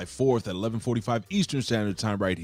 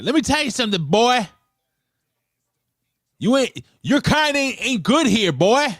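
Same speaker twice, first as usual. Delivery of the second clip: mock southern accent; 11 dB louder than usual. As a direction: imitate her southern heel taunt. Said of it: "The California accent just disappears".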